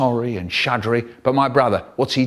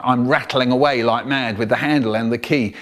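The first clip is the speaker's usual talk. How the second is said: ordinary delivery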